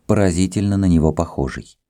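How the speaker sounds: ordinary delivery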